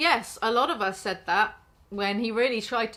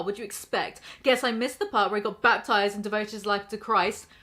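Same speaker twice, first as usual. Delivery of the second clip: normal talking voice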